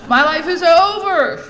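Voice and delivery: high, loud, slow wail; melodramatic teen wail. Retold or imitated. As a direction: wail it like a tragic teenager. Teen daughter wailing.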